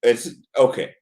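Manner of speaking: low, dismissive brush-off; dismissive okay. Real, not acted. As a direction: let it drop, unconvinced. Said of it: "don't say "okay" like that".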